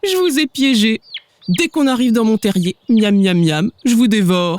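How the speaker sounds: lightly voiced greedy fox; a hint of a hungry villain; gloating fox. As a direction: relish the trap, miam miam. Fox gloats over trapped chicks.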